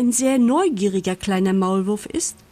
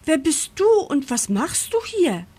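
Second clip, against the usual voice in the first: high-voiced startled questioning; 8 semitones higher than usual. A narrator's reading. Mole questions the stranger he bumped into, startled. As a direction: ask it high, taken aback. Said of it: "sagt Moritz erschrocken".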